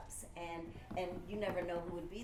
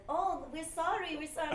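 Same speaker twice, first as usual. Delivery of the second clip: mild mock Italian accent, much higher; 10 semitones higher, 6 dB louder than usual. Ida apologizing for taking the table, light Italian accent. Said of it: "with a strong Italian accent".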